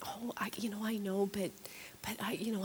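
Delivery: sheepish whispered stalling; stalling God with a hushed whisper; whispered excuse. Retold or imitated. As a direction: mumble the excuse under your breath. Acting her excuses to God.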